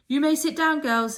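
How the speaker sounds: high, loud headmistress voice. A narrator's reading. Headmistress addressing the school: high loud formal voice.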